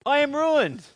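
high, loud cry of despair; ruined, thrown high and loud; anguished cry. Acted. Isaiah's cry read aloud.

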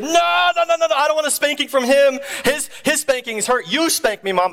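put-on high, pleading little boy; hammed-up kid begging off a spanking; fake-crying kid. Boy begging mom to spank him instead, fake-crying. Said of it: "I would fake cry".

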